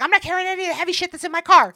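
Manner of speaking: high, fast, huffy protest; fast indignant refusal. Retold or imitated. Telling the car story, mock indignant refusing to carry anything.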